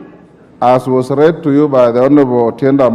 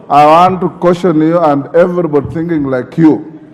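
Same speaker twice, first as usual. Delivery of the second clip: normal talking voice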